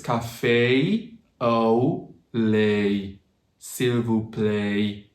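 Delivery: very slow CAFÉ AU LAIT, S'IL VOUS PLAÎT with long gliding vowels; overdone anglicized "café au lait, s'il vous plaît", drawn out; exaggerated English pronunciation. Shown as a demonstration. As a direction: stretch every vowel into AY-ee and OH-oo. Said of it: "So if I exaggerate".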